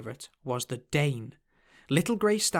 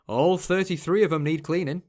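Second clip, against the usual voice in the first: put-on light Yorkshire accent, friendly and easy; a hint of a Yorkshire ferryman's voice; 2 semitones higher, 5 dB louder than usual. A narrator's reading. Ferryman Tact teasing Bo about leaving, light Yorkshire accent. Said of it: "in a Yorkshire accent".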